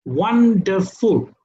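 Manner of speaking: "Wonderful" slowly split, won, der, ful; syllable by syllable. Shown as a demonstration. Teacher counting syllables for the class, syllable by syllable. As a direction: say "Wonderful" in three drawn-out, separate beats. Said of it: "That's three syllables".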